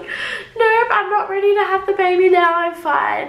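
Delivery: high, loud, quick refusal; high fast panicked denial. Retold or imitated. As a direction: deny being ready in a panic. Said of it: "I was like".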